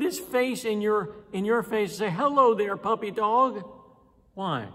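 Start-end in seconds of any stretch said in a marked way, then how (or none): 2.1-3.6 put-on high, loud baby-talk greeting; cooing at a dog. Preacher mimicking a fool cooing at a stray dog. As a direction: coo at the strange dog like a pet.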